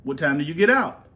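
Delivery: normal talking voice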